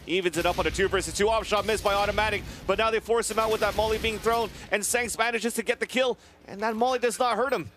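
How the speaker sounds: high, loud excited casting call; hyped play-by-play. Real, not acted. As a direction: call the clutch at full hype. Live play-by-play at a clutch.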